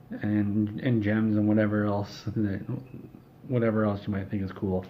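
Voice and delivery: ordinary delivery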